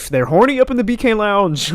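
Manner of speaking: loud, high mock exclamation; hammed-up punchline. Retelling the comedian's Burger King bit, loud and hammed-up.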